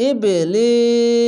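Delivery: ইবলিস drawn out very slowly in a chanted recitation voice; Quranic recitation. Shown as a demonstration. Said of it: "তার জন্য আমি পড়ে নিলাম".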